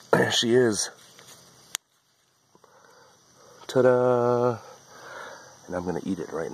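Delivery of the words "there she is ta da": proud, high and loud ta-da; excited reveal. Real, not acted. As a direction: show it off with a flourish. Showing off first green bean.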